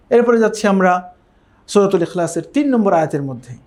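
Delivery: normal talking voice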